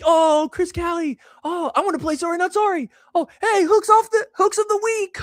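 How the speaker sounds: high-pitched mock kid excitement; all-excited kids. Two kids recognize the show's host at pickleball, mimicked all excited. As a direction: imitate starstruck kids squealing up high. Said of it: "they got all excited".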